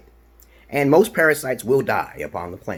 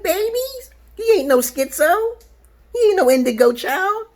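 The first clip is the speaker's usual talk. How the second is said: high squeaky boast, louder; mocking falsetto; 3 dB louder than usual. Bragging to viewers he can beat [name], in a high squeaky taunt.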